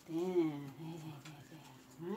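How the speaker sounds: low, slow soothing murmur. Real, not acted. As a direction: settle the dogs gently. Settling the dogs down.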